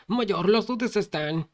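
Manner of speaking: high, slow urgent shout; shouted warning. A narrator's reading. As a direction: yell the warning up the stairs. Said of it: "gritó". (grito urgente y agudo)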